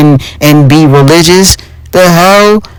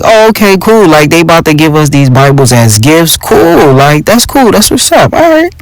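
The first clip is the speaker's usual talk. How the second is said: high, bright-eyed rising delight, climbing higher to the end; hammed-up innocent glee, pitch soaring on the last words; mock naive excitement; 3 semitones higher than usual. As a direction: play the clueless kid thinking it's gifts. Naive thoughts on seeing the Bibles.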